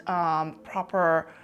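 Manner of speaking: normal talking voice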